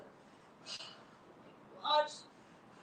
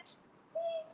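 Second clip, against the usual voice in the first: helpful flea; 2 semitones higher, much slower than usual. Acted. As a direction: chirp it brightly. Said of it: "she said".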